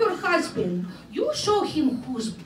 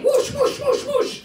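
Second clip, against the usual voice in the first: loud, high swoosh noises; mock battle whooshes, loud and high; whooshing sound effect; 9 semitones higher, 4 dB louder than usual. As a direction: shout the swings of a ball and chain. Swinging ball and chain.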